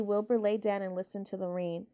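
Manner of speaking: usual speaking voice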